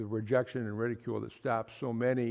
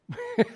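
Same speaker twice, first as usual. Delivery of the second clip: a quick, high burst of laughter; short high laugh; 4 dB louder than usual. Real, not acted. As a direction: let a short laugh pop out. Joke about mistreating each other.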